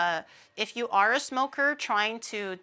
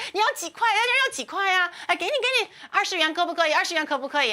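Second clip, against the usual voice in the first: much higher, pushy market seller calling after him; pleading vendor; 7 semitones higher, much faster than usual. Retold or imitated. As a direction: chase the customer with high, eager offers. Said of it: "the lady goes like".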